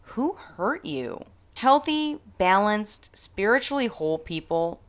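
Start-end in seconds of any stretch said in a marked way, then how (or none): 0.0-1.2 pitying. Pretend. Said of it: "I just wanna ask them".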